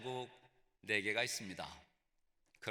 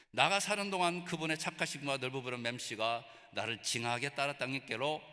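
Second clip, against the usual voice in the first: Jeolla dialect. Shown as a demonstration. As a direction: recite the psalm like a rustic Jeolla local. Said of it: "전라도 버전을 들어보십시오".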